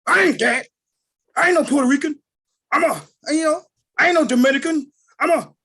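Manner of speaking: loud, offended denial; indignant protest. Retold or imitated. Retold man bristles at being called Puerto Rican, offended and loud. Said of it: "get you down there a fight".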